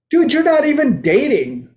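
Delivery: mock friend. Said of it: "Be like".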